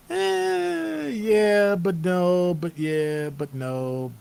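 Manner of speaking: high drawn-out eh then torn yeah but no; flip-flopping between yes and no, first very high; wavering reaction. Retold or imitated. First hearing the idea, torn yeah but no. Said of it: "I went".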